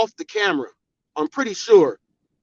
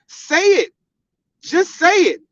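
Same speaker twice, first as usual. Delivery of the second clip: taunting dare; 7 semitones higher than usual. Acted. Taunting the rival directly.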